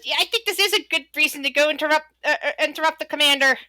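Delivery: high, quick character voice; second kobold guard. Acted. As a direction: hurriedly agree as a little guard. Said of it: "looks at the other and says".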